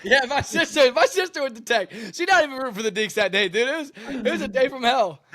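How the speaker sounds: talking through high laughter; can barely get the words out for laughing. Real, not acted. Quarterback laughing about his sister in rival jersey.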